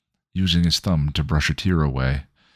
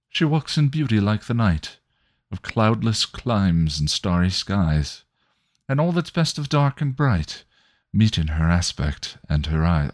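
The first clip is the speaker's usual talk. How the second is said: lilting, raised verse reading; poem recital; 5 semitones higher than usual. Acted. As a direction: savour each line of the poem. Recites Byron.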